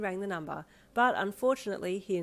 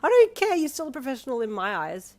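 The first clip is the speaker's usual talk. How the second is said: much higher and louder insistence; adoring; 7 semitones higher, 10 dB louder than usual. A narrator's reading. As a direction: insist lovingly. Adoring girlfriend.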